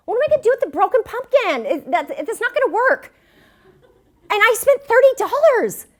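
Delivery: loud, high exasperation over the broken pumpkin; frustrated rant. Retold or imitated. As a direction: vent the frustration, pitch climbing. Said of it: "And I'm so mad".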